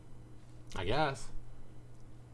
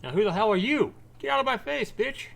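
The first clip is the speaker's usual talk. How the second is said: much higher, louder, hostile; angry yell; 12 semitones higher, 5 dB louder than usual. Acted. Widower shouting at the stranger approaching, angry. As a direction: bite her head off. Man snaps at arriving woman.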